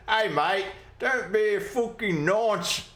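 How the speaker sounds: a bad mock British accent, like a bloke down the pub; terrible British accent. Joking to chat as a mock British bloke, in a hammed-up British accent. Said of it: "that's a, a terrible British accent".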